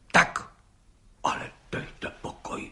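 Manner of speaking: hushed, breathless disbelief; a whispered come now; astonished. Acted. Judge to gendarme, whispering in astonishment. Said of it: "užasl pan soudce".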